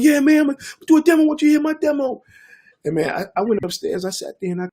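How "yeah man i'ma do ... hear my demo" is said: put-on much higher, fast eager kid voice. Youths offering their demos to the producer, high fast eager. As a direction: rush it out like a kid pitching his demo. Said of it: "they ended up going".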